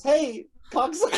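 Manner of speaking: high, rising call-out greeting; retold cheery shout. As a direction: holler it brightly across the yard. Retold neighbor greeted with a prank insult.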